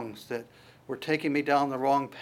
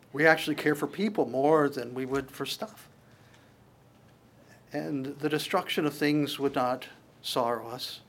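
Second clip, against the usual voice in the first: closing prayer. Real, not acted. Pastor closing the sermon praying for the congregation. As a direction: pray it sincerely to God.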